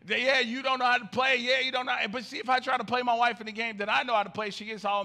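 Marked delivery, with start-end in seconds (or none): 0.0-2.0 mock trash talk. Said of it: "And she's like".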